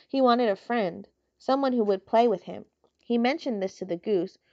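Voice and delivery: usual speaking voice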